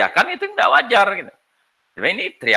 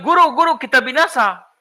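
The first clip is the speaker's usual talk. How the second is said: higher, urgent double call of guru; mock panicked cry; 7 semitones higher than usual. Luke's disciples calling to the teacher, mock alarm. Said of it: "Lukas bilang".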